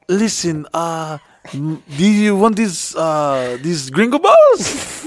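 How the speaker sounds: high-pitched, hammed-up Spanish accent; put-on Spanish accent. Shown as a demonstration. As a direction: slip into a mock Spanish accent, stretching out the last words. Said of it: "I'll be talking Espanol".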